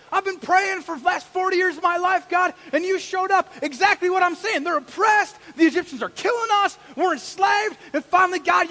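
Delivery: loud, high triumphant shouting; elated. Acted. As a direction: shout it out like a long-awaited answered prayer. Acts Moses elated at God's answer.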